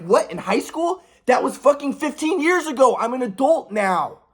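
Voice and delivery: high-pitched, indignant outburst; retold yelling. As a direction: protest heatedly, as if yelling back at mom. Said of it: "I'm not yelling at her like that".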